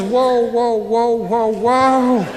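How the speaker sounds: drawn-out, laid-back whoas; a lightly voiced Southern guy reining him in; Georgia guy slowing him. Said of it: "He says".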